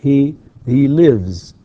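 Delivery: slow, loud "lives" with a buzzing vz; corrected voiced ending. Shown as a demonstration. Teacher correcting to the voiced form, slow Z ending. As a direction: draw out "lives" and finish on z. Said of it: "No, it's".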